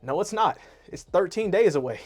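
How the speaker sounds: normal talking voice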